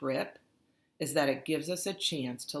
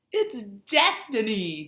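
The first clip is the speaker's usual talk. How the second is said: loud high excited yell; 7 semitones higher, 9 dB louder, slower than usual. A narrator's reading. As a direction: shout it like it's fate. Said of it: "Jake yells".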